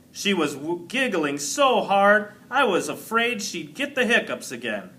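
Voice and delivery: ordinary delivery, nothing marked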